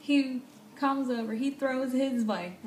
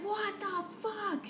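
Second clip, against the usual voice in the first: high, drawn-out incredulous cry; mock-outraged squeal, not shouted; retold outraged shock; 10 semitones higher, 4 dB quieter, slower than usual. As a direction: stretch it out high in disbelief. Retells fleeing the car.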